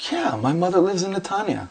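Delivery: casual high-pitched Southern California reveal; Southern Californian accent. Retold or imitated. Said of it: "with a heavy accent, like, this Southern Californian accent".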